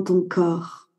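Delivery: calm Yoga Nidra voice with long pauses; guided meditation. Acted. Guide leading a listener through body awareness before sleep, guided meditation. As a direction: guide the listener inward in short, unhurried phrases.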